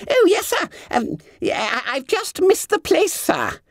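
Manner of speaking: high squeaky startled stammer; flustered fat schoolboy. A narrator's reading. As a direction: squeal it, caught out. Bunter flustered.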